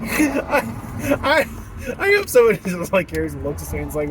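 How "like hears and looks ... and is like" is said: usual speaking voice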